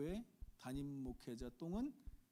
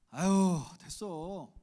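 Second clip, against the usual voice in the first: loud exclaimed "아유" then a casual "됐어"; mock-brush off the offer of help; Daejeon-style polite refusal; 5 semitones higher, 12 dB louder, much slower than usual. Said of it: "또 도와주겠다고 할 때". (도와주겠다는 말에 대전식으로 사양하는 흉내)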